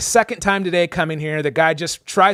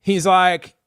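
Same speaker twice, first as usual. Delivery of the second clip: normal talking voice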